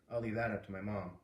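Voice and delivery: ordinary delivery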